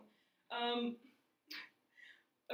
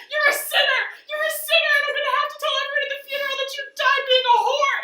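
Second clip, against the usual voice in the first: high, loud wailing accusation; imitated crying hysterics; 14 semitones higher, 14 dB louder, faster than usual. Crying sister accusing choking sibling, hysterical wail. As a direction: shriek it in mock hysterics. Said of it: "she starts crying".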